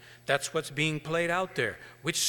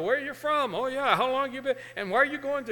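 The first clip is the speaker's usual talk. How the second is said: chatty farmer; 9 semitones higher than usual. Acted. Farmer chats with hitchhiker.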